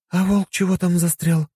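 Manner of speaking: lightly voiced frowning woman; a hint of a frown; frowning Katya. A narrator's reading. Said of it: "нахмурилась Катя". (недовольно, с нахмуренным видом)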